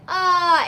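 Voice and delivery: high, loud "Ah" sung out; demo high cry. Shown as a demonstration. Vocal coach showing how to voice pain. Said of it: "maybe like".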